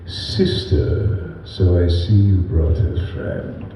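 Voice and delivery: low, slow, breathy-sounding villain hiss shifting into Palpatine; whispered Sith villain, low and slow. Shown as a demonstration. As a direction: slide the menace into a Palpatine rasp. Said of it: "slip it into a Palpatine thing".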